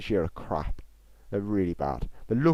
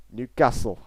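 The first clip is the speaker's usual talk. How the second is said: louder, badly faked Geordie; failed Geordie accent; 7 dB louder, slower than usual. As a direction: have a poor go at Geordie, punched out. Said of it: "I can't do it".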